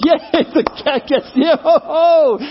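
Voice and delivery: high mock cheer with a laugh. Imitating listeners glad the cat died, mock cheering. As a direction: whoop gleefully as if your side won.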